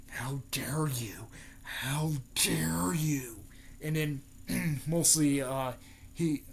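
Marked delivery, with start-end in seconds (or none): 0.1-3.4 slow, drawn-out furious whisper; whispered outrage. Retold or imitated. Naruto losing it at Neji, whispered outrage. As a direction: whisper it slowly, stretching each word in mock rage. Acting out enraged Naruto after hit.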